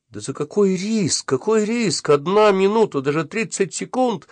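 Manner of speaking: high-pitched, flustered protest; agitated cry. A narrator's reading. Boy disputes the risk with his friend, agitated. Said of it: "закричал я в страшном и нелепом волнении".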